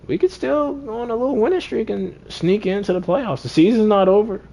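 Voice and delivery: squeaky burst of hope; high excited hype. Real, not acted. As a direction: sell the comeback like a fan who believes. Hyping comeback hopes.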